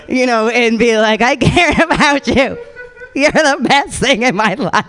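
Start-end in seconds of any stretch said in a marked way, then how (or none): 1.2-4.8 mock snot cry. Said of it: "snot cry".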